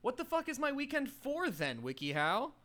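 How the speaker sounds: high indignant protest; mock outrage. Real, not acted. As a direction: act betrayed by the advice. Outraged at WikiHow tip.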